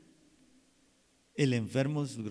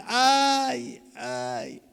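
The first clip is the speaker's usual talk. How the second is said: complaining moan; 10 semitones higher, 7 dB louder than usual. Shown as a demonstration. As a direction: groan "Ay, ay" like a sick person. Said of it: "En vez de decir".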